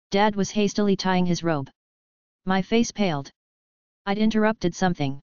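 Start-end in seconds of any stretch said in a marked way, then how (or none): none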